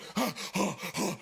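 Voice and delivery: heavy huffing breaths; imitated panting. Storyteller acting out his sprint, heavy panting. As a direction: puff like a runner.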